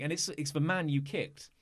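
ordinary talk

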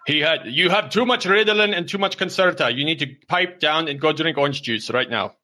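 high, exasperated mock telling-off; scolding father. Father ordering hyper Junior to pipe down, high scolding. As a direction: rant at him like a worn-out dad.

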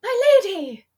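high, loud knightly call; gallant knight. Retold or imitated. Sir Didymus calling to Sarah, high loud devotion. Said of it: "he used to be like".

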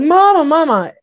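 high, loud call of recognition. Retold or imitated. Recognizing mama aboard the craft, calling high and loud. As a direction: cry out to her, startled. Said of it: "And I was like".

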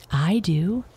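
plain reading voice

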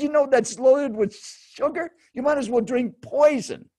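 high-pitched, insistent mock warning; retold stranger's indignant lecture; imitated scolding. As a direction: scold like an appalled health zealot, acted. Imitating seatmate's scolding about Pepsi.

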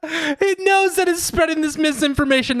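high, mock-scandalised voice; high mock outrage. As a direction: squeak it out in mock shock. Mock conspiracy about the AI.

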